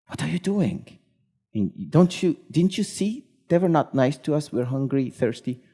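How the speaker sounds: indignant scolding of a friend; losing his temper. Retold or imitated. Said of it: "Moses again loses temper".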